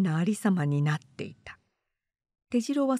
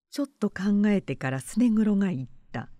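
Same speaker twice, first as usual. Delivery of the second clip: plain reading voice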